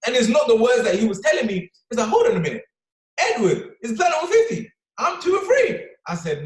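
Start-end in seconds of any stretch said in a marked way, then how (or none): 2.1-5.9 rising with disbelief; high-pitched disbelieving excitement; excited realization. Retold or imitated. Realizing excitedly in retold thought.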